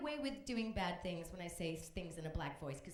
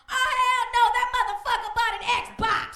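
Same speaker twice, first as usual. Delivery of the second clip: very high, loud and laughing; outraged high yell; 16 dB louder than usual. Acted. A witness yelling about the ex who bought an Xbox.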